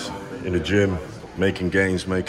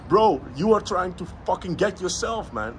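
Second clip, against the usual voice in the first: raised, pushing motivational rant; heated rant; 8 semitones higher than usual. Real, not acted. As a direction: lecture the lazy viewer hard.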